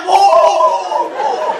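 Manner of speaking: long, high, loud imitation moo; cow mooing. Dying cow's last words, loud imitated mooing. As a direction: bellow a drawn-out mock moo. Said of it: "פרה בטח לפני שהיא מתה".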